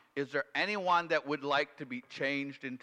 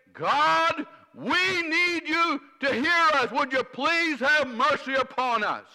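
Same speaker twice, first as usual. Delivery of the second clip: high, loud, slowed plea to God; pleading cry; 13 dB louder, slower than usual. Acted. Preacher voicing the people of Nineveh crying to God, pleading. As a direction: cry out for mercy. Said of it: "there was a cry that was saying".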